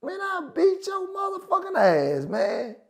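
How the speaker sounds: high-pitched mock-angry threat; high, strained retold outburst. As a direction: squeal the threat in indignation, without real volume. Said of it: "I said, Man".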